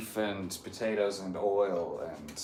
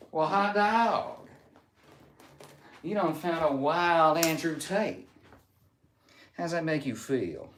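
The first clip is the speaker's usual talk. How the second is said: put-on Southern accent; 5 semitones higher than usual. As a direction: put on a folksy Southern twang. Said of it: "I'm going Southern accent".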